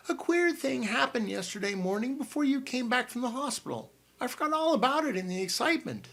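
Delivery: an excited aunt bursting into the room; Aunt Matilda. A narrator's reading. Aunt Matilda bursting in on the boys, high and bustling.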